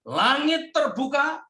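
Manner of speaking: slow, lifted proclamation of scripture; preacher reading the gospel grandly; solemn scripture reading. Acted. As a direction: proclaim it with awe, slowly. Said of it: "Kata firman Tuhan begini".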